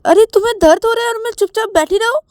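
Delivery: high, loud indignant outburst; shrieked indignant question; agitated yelling. Acted. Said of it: "तुम चिल्ला क्यों रहे हो".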